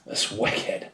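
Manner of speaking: put-on breathy whisper in Boston accent. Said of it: "doing really bad with the Boston accent".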